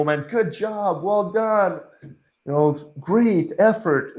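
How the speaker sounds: high, slow, sing-out congratulations; effusive praise. Acted. First person to congratulate others, effusive praise. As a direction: cheer someone on, stretching the praise.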